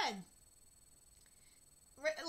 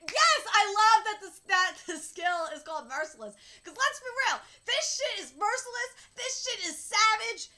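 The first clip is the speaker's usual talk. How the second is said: loud, raised, hyped-up delight; thrilled outburst; 5 semitones higher, 11 dB louder than usual. Real, not acted. Reader hyping up the skill Merciless, thrilled. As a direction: cheer it loudly and relish it.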